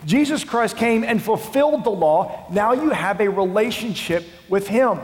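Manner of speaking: high, loud, impassioned plea; fervent preaching shout. Acted. Paul writing to the Galatians church, fervent loud plea. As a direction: proclaim it like Paul rebuking the church. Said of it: "and says".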